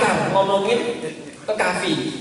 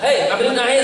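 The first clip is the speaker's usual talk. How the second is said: higher, brusque hey; rough rude call; 6 semitones higher than usual. Shown as a demonstration. Host rudely ordering his child in front of guest, brusque. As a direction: bark it without manners. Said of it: "Karena kalau ngomongnya".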